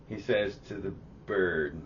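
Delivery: ordinary talk, nothing marked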